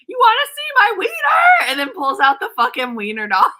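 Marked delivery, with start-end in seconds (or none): 0.1-1.7 much higher, much louder mock rock-star taunt; hammed-up rocker showing off to the crowd. Retelling [name]'s stage stunt, a loud mock taunt. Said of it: "and said".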